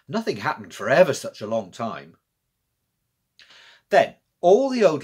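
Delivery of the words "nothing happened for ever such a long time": usual speaking voice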